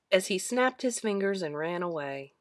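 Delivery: ordinary delivery, nothing marked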